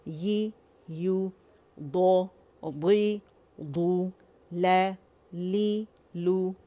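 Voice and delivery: slow drawn-out syllables; syllable drill. Shown as a demonstration. Teacher drilling students, slow syllables. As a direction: sound each syllable clearly. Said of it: "page दूसरा आप कर लीजिएगा".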